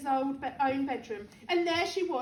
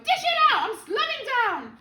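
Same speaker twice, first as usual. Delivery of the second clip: high, loud, urgent order; demanding yell; 9 semitones higher, 7 dB louder than usual. A narrator's reading. Grandma slowing down, wanting more, demanding yell. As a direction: yell for the next dose. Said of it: "she yelled".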